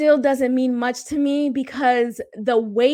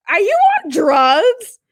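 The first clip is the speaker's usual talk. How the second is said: higher, slow, incredulous snap; snapping back; 6 semitones higher, 5 dB louder than usual. Retold or imitated. Snapping back at the ex who acts like nothing happened. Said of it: "snapped back".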